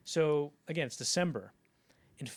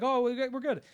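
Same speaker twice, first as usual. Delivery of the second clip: much higher, louder quick reply; crew reply; 11 semitones higher, 7 dB louder than usual. Retold or imitated. Crew member reassuring the director, high loud reply. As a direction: chirp it back, high and loud.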